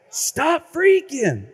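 high, slow and stretched; high slogan. Shown as a demonstration. Preacher repeating the jingle line to the crowd, high and drawn. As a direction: sing the slogan out. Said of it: "slogan repeated for effect".

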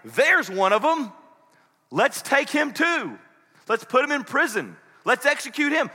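high, slow accusing calls; acted hostile cries; accusing shouts. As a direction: call out as if rousing a mob. Imagined accusers spotting a disciple.

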